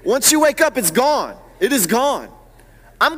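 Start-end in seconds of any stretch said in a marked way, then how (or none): none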